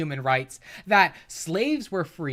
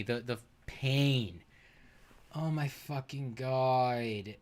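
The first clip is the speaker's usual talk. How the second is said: weary dismay; 4 semitones lower, 6 dB quieter, slower than usual. Real, not acted. Remembers painful argument with weary dismay.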